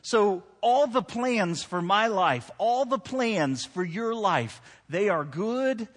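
full-force pulpit delivery; preaching. Acted.

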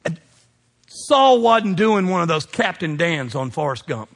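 usual speaking voice

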